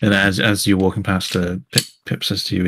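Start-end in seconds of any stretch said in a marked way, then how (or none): none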